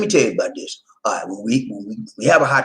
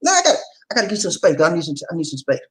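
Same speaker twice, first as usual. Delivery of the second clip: rushed, higher playful protest; fast joking protest; 7 semitones higher, much faster than usual. Real, not acted. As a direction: blurt it out fast, teasing. Joking to wife.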